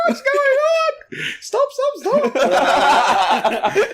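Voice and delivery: very high, squealed alarm; hammed-up panicked crew, laughing; mock panic. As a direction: imitate a crowd freaking out in falsetto. Mimics the crew panicking on set.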